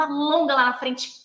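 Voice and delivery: quick, vigorous coaching cue; brisk rhythm. Shown as a demonstration. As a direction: keep it moving on the beat. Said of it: "Eu vou dar esse ritmo". (ritmo ágil e vigoroso)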